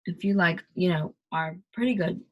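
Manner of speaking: ordinary delivery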